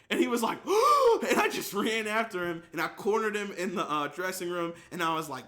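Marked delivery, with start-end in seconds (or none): 0.6-1.2 loud, high mock gasp; big gasp of someone caught out; startled gasp. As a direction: act out a sudden panicked gasp. Friend's reaction in story.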